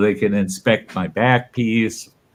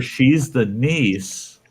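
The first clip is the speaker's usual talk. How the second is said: ordinary delivery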